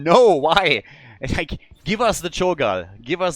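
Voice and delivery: loud indignant no why; mock outrage. Real, not acted. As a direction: protest the ban in disbelief. Outraged at the ban.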